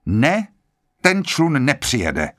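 a touch of impatience, lightly voiced; irritated, impatient. A narrator's reading. General cuts in on Blore and Lombard with mild impatience. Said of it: "Podrážděný, netrpělivý hlas".

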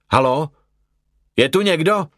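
high, searching call. A narrator's reading. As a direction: call into a silent house for anyone. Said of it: "zavolal jsem".

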